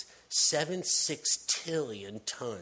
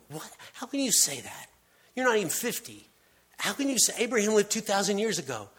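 incredulous crowd; 6 semitones higher, faster than usual. Acted. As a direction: scoff at him in outraged disbelief. Hostile crowd disputes Jesus.

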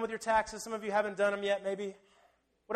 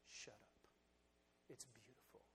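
hushed rebuke; 10 semitones lower, 34 dB quieter than usual. Acted. Jesus rebuffs disciples.

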